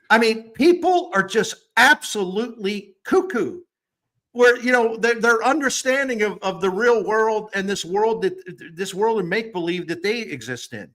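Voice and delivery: heated disbelief at critics; worked up. Real, not acted. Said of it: "I get worked up about this".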